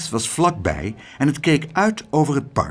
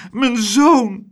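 sobbing; 10 semitones higher, 5 dB louder than usual. Acted. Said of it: "snikte ze".

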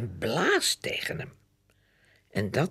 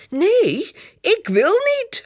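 high, loud wailing protest; crying no; 8 dB louder, slower than usual. Acted. Boy wails at his sister with the hose, high and loud. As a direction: wail like a scared little boy. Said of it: "huilt Jip".

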